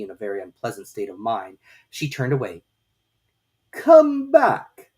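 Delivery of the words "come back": high, loud call in a strange voice; calling after. A narrator's reading. Caterpillar calling after Alice as she leaves, loud and high. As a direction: call her back across the distance. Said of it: "the caterpillar called after her".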